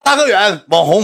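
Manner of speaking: high excited fan voice. Retold or imitated. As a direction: mimic a fan shouting a name. Fan calling out again.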